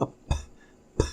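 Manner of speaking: "p" as a soft puff from the lips; bilabial P. Shown as a demonstration. Teacher isolating the lip sound for learners, a puffed "p". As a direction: pop "p" with no full vowel. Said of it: "bilabial sound".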